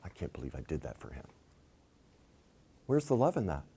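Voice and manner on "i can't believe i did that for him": fast, unvoiced whispered curse under the breath; inner grumble. Resentful spouse cursing silently inside, a fast whisper. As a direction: hiss the regret to yourself, fast. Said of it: "And inside you're going".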